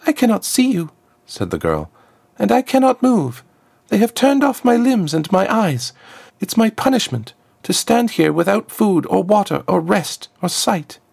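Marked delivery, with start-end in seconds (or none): 0.1-0.9 put-on much higher, tense girl's voice; a helpless girl expecting death; frightened trapped girl. Trapped girl asks the stranger if she came to kill her, tense and high. 2.4-11.0 put-on much higher, worn-out girl's voice; dull rasping girl. Trapped girl describes her torment to Azriakam, a dull high rasp. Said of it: "The words were a dull rasp".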